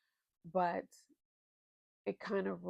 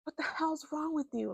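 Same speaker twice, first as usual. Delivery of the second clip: higher pitched indignant outburst; mock exasperation; 8 semitones higher than usual. Reader yelling at a book character, mock exasperated. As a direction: scold the character in disbelief. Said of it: "I was like".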